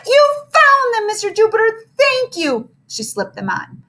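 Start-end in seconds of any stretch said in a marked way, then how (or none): 0.1-2.7 delighted. Acted. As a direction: squeal with joy. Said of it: "clapped her hands in delight".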